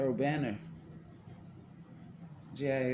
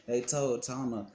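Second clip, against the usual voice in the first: ordinary delivery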